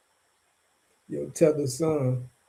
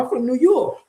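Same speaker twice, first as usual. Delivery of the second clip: higher-pitched mimicry of a forced New York accent; lightly mock someone putting on New York; 9 semitones higher than usual. Mocking immigrants who claim they're down, forced New York accent. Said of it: "they can have that accent".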